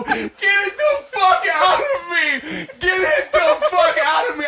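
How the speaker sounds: high, loud, laughing scream to get it out; panicked yell. Retold or imitated. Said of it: "I literally go".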